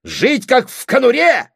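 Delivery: high, loud outburst; scornful shout. Acted. Brother scorning a life in poverty, scornful shout. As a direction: exclaim with outraged contempt.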